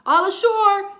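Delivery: called out loud and high; men hollering from the ship; shouted. A narrator's reading. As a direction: crew calling all ashore. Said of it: "the men shouted".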